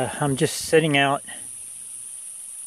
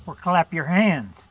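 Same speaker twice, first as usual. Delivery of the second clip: raised, lilting call into the woods; 4 semitones higher than usual. Real, not acted. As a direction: call out gently to someone unseen, pitched up. Calling out to unseen woodland friends.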